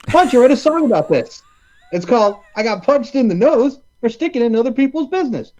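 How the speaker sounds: high, bright lilting quote; sing-song mock country singer; lightly voiced comic singer; singer character. Quoting film singer.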